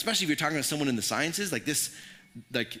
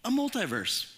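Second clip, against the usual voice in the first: pitched up, mock wonder at a discovery; preacher mocking a flashlight discovery with pretend wonder; mock amazement; 8 semitones higher than usual. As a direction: play it as a pretend gasp of discovery. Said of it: "we don't shine a flashlight like".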